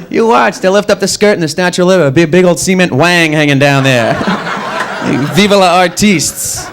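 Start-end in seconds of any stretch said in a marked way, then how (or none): none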